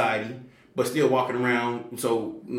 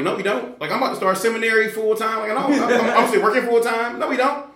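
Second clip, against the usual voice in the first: high, loud, laughing no way; turning down his friend's plan, high and laughing; incredulous refusal; 10 semitones higher, 5 dB louder than usual. Retold or imitated. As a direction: push the idea away with a laugh.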